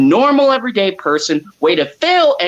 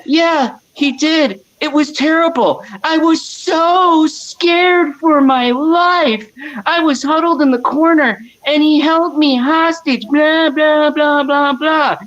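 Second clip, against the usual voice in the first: mocking Amber; 7 semitones higher than usual. Host mimicking Amber's interview, mock-scared higher voice. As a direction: whine it like a fake victim. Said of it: "And she said".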